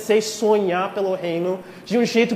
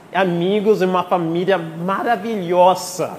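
prayer; 4 semitones lower than usual. Acted. Leads a prayer.

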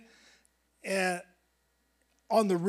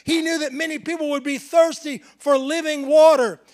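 loud, raised preaching cadence building on each phrase; fiery preaching; 6 semitones higher, 7 dB louder than usual. Acted. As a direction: preach it out to the whole church. Preaching at full force.

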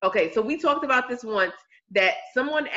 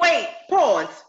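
higher, louder sudden halt; loud call to stop; 5 semitones higher, 4 dB louder than usual. Real, not acted. Cutting in on her co-host, a loud call to stop. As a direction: stop everyone mid-sentence, loud.

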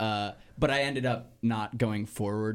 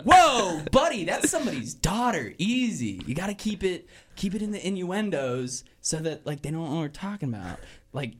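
serious scolding; 7 semitones higher than usual. Acted. Greasers telling their crude friend off, serious. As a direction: rein your buddy in, urgently. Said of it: "got serious".

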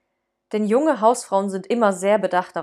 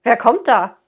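startled call; 3 semitones higher, 8 dB louder than usual. Acted. Startled nephew calling out.

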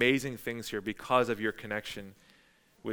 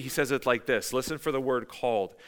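ordinary talk

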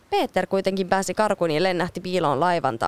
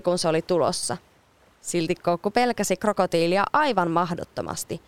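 ordinary delivery, nothing marked

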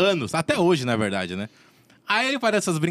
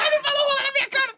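laughing; faster than usual. Real, not acted. Cracking up at the game.

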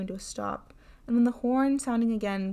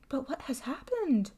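higher-pitched, mildly amazed question; 5 semitones higher than usual. A narrator's reading. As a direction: ask your sister in puzzled astonishment. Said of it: "asked her sister, amazed".

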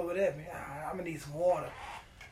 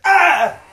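sudden high yelp, much louder; shocked scream; 11 semitones higher, 18 dB louder than usual. Retold or imitated. Game character gets shocked by the light switch, screaming. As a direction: yelp like you just got zapped.

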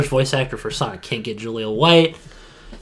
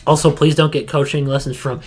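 ordinary talk, nothing marked

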